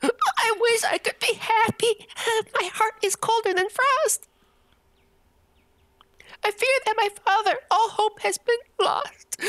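high mock crying; mock sad princess. Princess laments her father, high mock crying. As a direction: whimper it high in mock sorrow.

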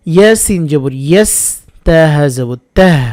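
normal talking voice

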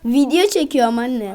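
loud whining complaint to mother; insistent protest. Real, not acted. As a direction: insist loudly that you are busy. (annesine ısrarla sitem)

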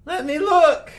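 high, loud put-on shopkeeper voice. Shopkeeper off to look for a waterproof sack, high character voice. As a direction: pipe up loudly as the eager storekeeper.

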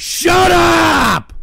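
annoyed shout; shut up yell. Real, not acted. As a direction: snap loud at the noise. Yells at looping soundboard.

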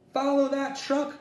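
put-on boy urging dad to follow the truck, crying out; urgent cry. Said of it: "cried Buzz".

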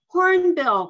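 high, loud call. A narrator's reading. Girl calls to the hornbill, high and loud. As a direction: call up to the bird. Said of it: "called Lindy Wei".

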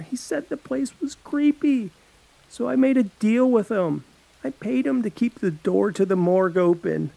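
high, anxious confession; boy confessing his plan to the girl; pleading teen boy. Acted. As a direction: spill the whole plan in a rush of shame. Alex confessing, voiced figure.

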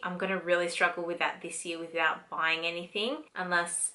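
normal talking voice

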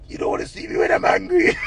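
strained mock-menacing threat; hammed-up menace breaking into laughter; angry man. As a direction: imitate an angry man's catchphrase. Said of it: "everything the man angry about".

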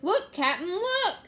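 loud cry climbing very high; thrilled crewman's holler; excited shout. A narrator's reading.

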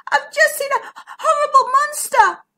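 very high, frightened little voice; gasped fright. A narrator's reading. Zara tells her cousin of a monster, gasping in fright. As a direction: squeal it in storybook alarm. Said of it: "she gasped".

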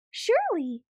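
cheerful eager bunny; eager reply. A narrator's reading. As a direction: answer brightly, keen to help. Bunny oddly helpful answering Sister.

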